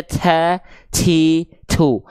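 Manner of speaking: TA, TI, TU turned into "cha, chi, chu"; ta read as C. Shown as a demonstration. Teacher warning learners, showing ta misread as ca, ci, cu. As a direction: soften the t into a ch sound: ca, ci, cu. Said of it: "baca kayak huruf C".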